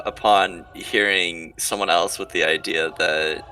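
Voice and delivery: ordinary talk, nothing marked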